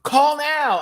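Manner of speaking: high, loud mock sales order; ad pitchman. Cohost imitating college commercial, mock urgent pitch.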